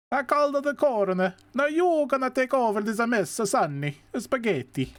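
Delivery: high, hammed-up Italian accent; heavy Italian accent. Officer Gillespie passing the case to Sonny, in a heavy Italian accent. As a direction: hand over the mess in a thick Italian accent. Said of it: "It is heavy Italian accent".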